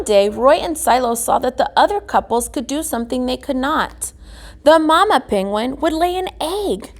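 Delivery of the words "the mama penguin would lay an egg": ordinary delivery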